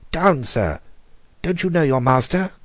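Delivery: high, loud furious order; roared command. A narrator's reading. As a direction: command the beast to back down. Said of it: "he roared".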